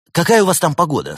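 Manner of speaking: bright-eyed lad answering the helpline; cheerful young technician. A narrator's reading. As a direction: play an upbeat young support worker. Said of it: "молодой и жизнерадостный".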